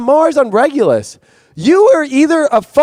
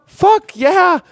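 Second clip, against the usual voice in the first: high, punchy fuck yeah; fired up; 8 semitones higher than usual. Real, not acted. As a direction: shout it like a cheer. Astrology rant exclamation.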